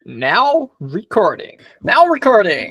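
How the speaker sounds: high, swooping American robot announcement; American bot accent. Acted. Mimicking Discord's recording bot for co-host, exaggerated American accent. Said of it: "in, like, a really American accent".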